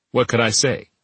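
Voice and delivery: ordinary delivery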